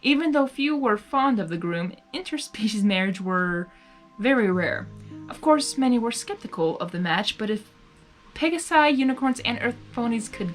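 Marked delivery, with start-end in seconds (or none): none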